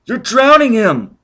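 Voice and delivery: woman shouting to stop him; crying out. Acted. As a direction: cry out in alarm. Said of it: "Robin cried".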